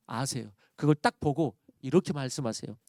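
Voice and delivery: ordinary talk, nothing marked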